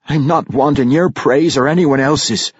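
put-on defiant young dwarf answering his mother; angry. A narrator's reading. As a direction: throw the praise back angrily. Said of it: "his face a mask of anger".

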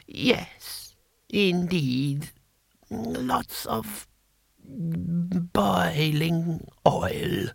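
high, soft, very slow relish; halting, drawing out the last words; ancient emperor, gleeful. Acted. Emperor savouring the sentence, high soft drawl.

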